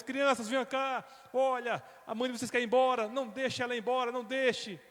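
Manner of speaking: put-on high urgent call to the children; rally the kids to stop her. Father calling the children to stop their mother, urgent. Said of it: "chamou as crianças".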